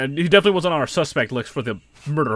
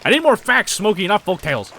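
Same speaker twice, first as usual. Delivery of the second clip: insistent demand; 8 semitones higher, 9 dB louder than usual. Acted. Magdalene demanding.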